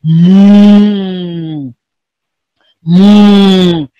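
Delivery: long, low moo; cow moo. Shown as a demonstration. Teacher repeats the cow's sound for pupils, mooing. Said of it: "let's do that of a cow".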